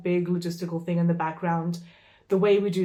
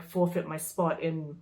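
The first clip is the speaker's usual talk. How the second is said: ordinary talk, nothing marked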